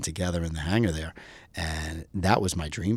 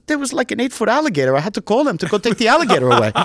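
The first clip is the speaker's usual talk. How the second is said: put-on lightly Greek-accented, higher and louder, brisk; 14 semitones higher, 9 dB louder, faster than usual. Greek buddy recounting the alligator, lightly Greek-accented. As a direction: tell it like an amused Greek buddy. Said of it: "my friend with the heavy Greek accent".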